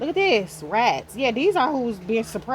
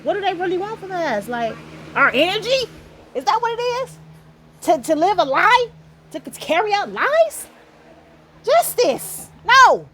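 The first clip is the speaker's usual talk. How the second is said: indignant outburst; 8 semitones higher, 4 dB louder than usual. Real, not acted. Ranting at the harassers.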